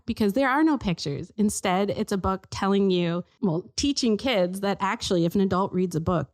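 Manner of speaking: usual speaking voice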